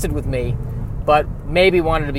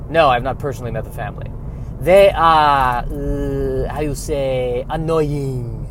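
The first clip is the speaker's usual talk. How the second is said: put-on French Canadian accent. Trader describes family.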